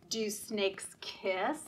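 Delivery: usual speaking voice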